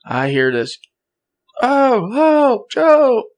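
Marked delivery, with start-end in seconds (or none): 1.6-3.2 high-pitched imitation of a cry for help; drawn-out, high mock scream; retold distant scream. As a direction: mimic someone screaming out in the woods, voice held back. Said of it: "It was another person screaming".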